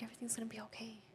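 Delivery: soft, fast whisper to herself; whispered self-reassurance. Retold or imitated. Alone in her car, whispering comfort to herself. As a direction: breathe it out, barely voiced, to calm down.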